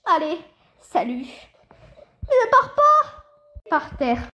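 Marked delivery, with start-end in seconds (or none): 2.2-3.2 slow, high, loud plea; pleading shout. Acted. Father begging his daughter not to leave, slow loud plea.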